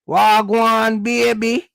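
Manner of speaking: light Jamaican patois pickup line; Jamaican accent. Retold or imitated. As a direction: slide into a casual Jamaican accent, flirting. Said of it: "oh, that accent".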